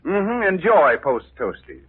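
bright, upbeat announcer pitch for cereal; high, eager commercial announcer read; radio ad read. Acted. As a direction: sell it like a wartime radio sponsor. Sponsor ad read before the story.

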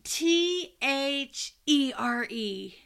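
slow, letter by letter; excited kid spelling. Retold or imitated. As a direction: spell it out eagerly, one letter at a time. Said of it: "my little humans was so excited".

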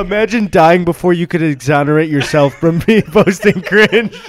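loud, laughing through the words; high, incredulous laughing outburst; cracking up. Real, not acted. Cracking up at own joke.

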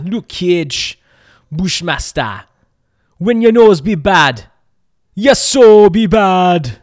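loud, slow Jamaican tagline voice; Jamaican accent. Retold or imitated. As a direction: belt it slowly in a Jamaican accent. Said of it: "whenever I do Jamaican accent".

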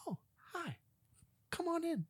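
high, soft falsetto hostess voice; mock polite homeowner inviting them in; mock lady host. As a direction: squeak it out like an oddly cheerful host. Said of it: "This lady".

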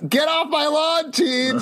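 high angry shout off the lawn; shouting at trespasser. Pretend. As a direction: yell like a cranky neighbor. Cranky old man at teens.

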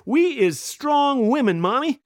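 put-on high, slow little-girl echo; toddler echo. Toddler proudly echoing her mom, slow and high. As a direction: proudly repeat like a two-year-old.

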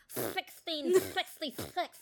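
Sylvester lisp. Retold or imitated. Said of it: "you end up being Sylvester".

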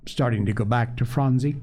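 ordinary delivery

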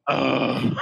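low, drawn-out mock "ugh"; imitated disgruntled grunt. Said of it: "he just goes".

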